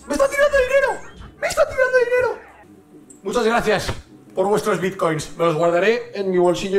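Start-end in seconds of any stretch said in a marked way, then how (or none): 0.1-2.4 high shriek. Real, not acted. Shrieks as enemy throws money.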